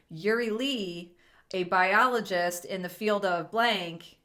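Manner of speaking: slow read with a clear pause after "Yuri Lee,"; pause after name. Shown as a demonstration. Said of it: "see how I have to pause there?".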